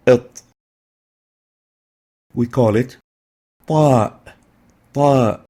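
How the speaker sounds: ta spoken heavy and emphatic; emphatic ta demo. Shown as a demonstration. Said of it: "Lingual alveolar letter".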